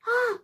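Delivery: high, comic gasp; imitated gasp. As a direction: mimic a startled intake as the door slips. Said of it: "like that".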